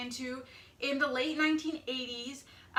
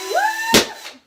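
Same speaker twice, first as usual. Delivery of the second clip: very high loud whoop; excited whoop; 12 dB louder than usual. Real, not acted. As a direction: scream the woo. Balloon pop cheer.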